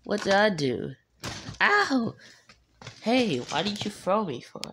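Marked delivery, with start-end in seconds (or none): 1.6-2.1 sharp high ow; high yelp of pain. Pretend. Toy thrown to ground.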